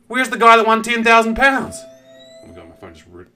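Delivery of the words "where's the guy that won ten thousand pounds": louder and higher, demanding; indignant. Real, not acted. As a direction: call it out in outrage. Outraged at missing winner.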